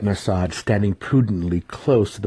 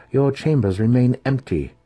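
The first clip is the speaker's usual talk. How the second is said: haughty matron; 6 semitones higher, 4 dB louder than usual. Acted. Matron presses him.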